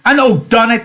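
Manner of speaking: crying out. A narrator's reading. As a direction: cry out the accusation. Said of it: "he cried".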